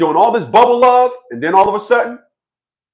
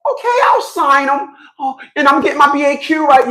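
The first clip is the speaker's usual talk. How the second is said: mock wife voice; 8 semitones higher than usual.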